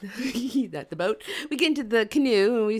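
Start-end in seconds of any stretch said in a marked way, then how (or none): 0.0-1.2 slow, chuckled self-correction; laughing. Real, not acted. Storyteller corrects boat to canoe, laughing. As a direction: laugh at your own slip. Laughs at own slip of word.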